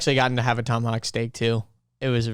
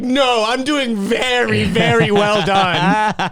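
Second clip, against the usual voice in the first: very well done dragged out slowly; slow drawn-out punchline; 7 semitones higher, 5 dB louder, much slower than usual. Retold or imitated. Joking to a friend about the overcooked steak, slow drawn out. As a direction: stretch each word slowly for the joke. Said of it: "you're like".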